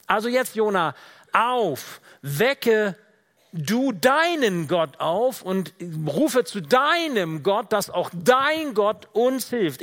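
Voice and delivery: loud, high, emphatic wake-up call; urgent command. Acted. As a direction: order a sleeper up, slowing on key words. Acts captain waking Jonah. (lauter, drängender Weckruf)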